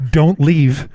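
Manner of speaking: loud, imitated plea from the crowd; retold woman's shout; lone call-out. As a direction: call after him across the room. Lone woman calling out repeatedly.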